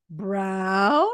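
"Brown?" drawn out with a rising end; questioning. Retold or imitated. Reading out the husband's texted answer, questioning. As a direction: stretch "Brown?" into a doubtful question. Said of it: "Question mark".

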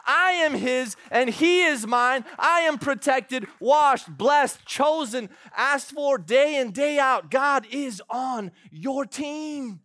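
loud, high, soaring declaration; preaching at full force. Acted. Pastor rallying the congregation, preaching at full force. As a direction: lift the congregation with every word. Rousing climax of sermon.

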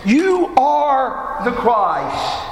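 slow, with each word given weight and "You" stressed; solemn, deliberate proclamation; weighty declaration. Shown as a demonstration. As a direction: let it land like a confession of faith. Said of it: "those words were filled with meaning and power".

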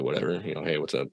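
low, soft brush-off; mumbled greeting, not wanting to engage; dismissive. Retold or imitated. Angry teen brushing off friends upstairs, dismissive.

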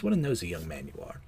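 lightly acted orderly, low and soft; sinister orderly. Sinister orderly chides Peter.

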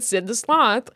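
ordinary talk, nothing marked

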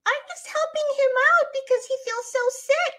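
put-on very high, loud little kid voice; child. Shown as a demonstration. Kid explains to mother about helping someone sick, high put-on child voice. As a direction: squeak it out like a small child explaining. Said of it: "And then the kid will be like".

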